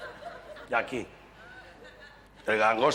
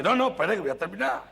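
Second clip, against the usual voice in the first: put-on Andalusian accent, higher pitched, slowing on la sota; Andalusian man insisting; 9 semitones higher than usual. Andalusian man finishes counting cards, mock Andalusian insistence. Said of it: "un andaluz".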